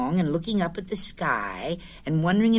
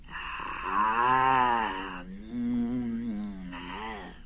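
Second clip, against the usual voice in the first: low satisfied ahh and mm; contented eating sounds; 8 semitones lower, 5 dB quieter, much slower than usual. Acted. Pooh tasting honey, low contented mm. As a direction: savour a mouthful of honey.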